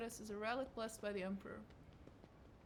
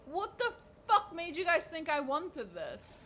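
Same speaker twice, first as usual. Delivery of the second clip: higher, louder slow outraged shout; outraged yell; 8 semitones higher, 7 dB louder, slower than usual. Real, not acted. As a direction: blow up in disbelief.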